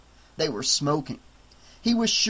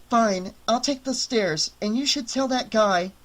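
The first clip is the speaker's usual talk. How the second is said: Jess's voice; 7 semitones higher than usual. Acted. Jess heads for stairs.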